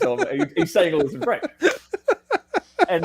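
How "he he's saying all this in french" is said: usual speaking voice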